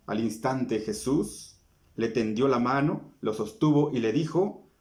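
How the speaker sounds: usual speaking voice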